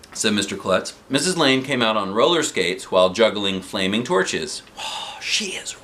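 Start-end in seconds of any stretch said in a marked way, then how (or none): 4.8-5.8 whisper. Acted. Aside whispered.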